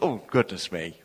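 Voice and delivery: low, soft tut of dismay; mock quiet disapproval; disapproving. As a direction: imitate a prim objector, under the voice. Imagined disapproval of the shouting minister.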